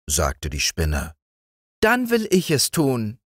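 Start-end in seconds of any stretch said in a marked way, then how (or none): 1.8-3.2 sehr hohes, feines Stimmchen; Spinnenstimme. A narrator's reading. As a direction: als winzige Spinne antworten. (Spinne antwortet den Kindern mit sehr hohem Stimmchen)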